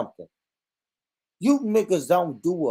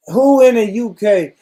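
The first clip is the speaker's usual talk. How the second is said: loud challenge; 6 semitones higher, 7 dB louder than usual. Real, not acted. Challenging chat.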